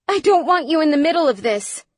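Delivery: high, loud, rushed plea; urgent, fast outburst; crying out. A narrator's reading. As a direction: cry it out in desperation. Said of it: "Phoenix cried".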